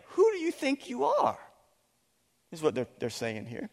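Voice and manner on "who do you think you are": high-pitched mocking sneer of the accusers; indignant challenge. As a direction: throw it back like an offended crowd.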